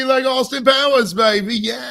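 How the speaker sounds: high, loud hammed-up British swinger; Austin Powers. American showing how a UK accent slips, hammed-up impression. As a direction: burst into an over-the-top UK accent. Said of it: "You instantly turn into Austin Powers".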